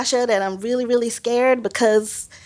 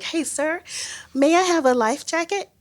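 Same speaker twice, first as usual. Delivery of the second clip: acted small, high-pitched plea; meek request; 8 semitones higher than usual. Nervous snorkeler asking the guide, meek request. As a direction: ask timidly, pitched up and polite. Timidly asking for life jacket.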